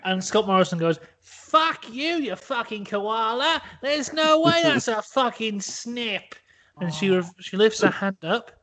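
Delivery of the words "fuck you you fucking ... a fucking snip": high, slow mock Australian rage; angry Aussie. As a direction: spit it like an outraged Aussie. Morrison raging at Amanda Holden.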